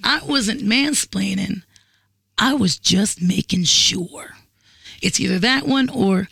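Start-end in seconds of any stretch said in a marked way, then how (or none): 0.0-4.3 slow, drawn-out mock excuse; mock mansplainer. Woman imitating a man excusing his mansplaining, slow and deliberate. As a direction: stretch each word, imitating a man justifying himself. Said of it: "women know the end of this".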